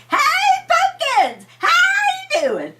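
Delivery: very high, loud, cheery hey pumpkins; happy shout. Acted. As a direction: burst out with a happy yell. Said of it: "he's pretty happy most of the time".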